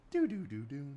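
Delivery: high sung shutdown chime; Windows shutdown jingle. Retold or imitated. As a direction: sing the computer shutting down. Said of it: "you hear that window sound".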